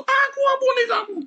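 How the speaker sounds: very high, loud answering yell; hammed-up screamed reply; shouting. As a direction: yell it back at full pitch. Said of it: "Kalau dua-duanya sambil teriak".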